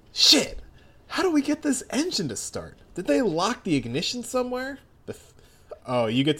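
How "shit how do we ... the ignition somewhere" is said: put-on higher, urgent young man voice; panicked trying to start the engine; high character voice. Voicing Anasui in the game.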